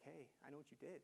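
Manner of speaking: lightly voiced colleague, hushed; quiet retold confession.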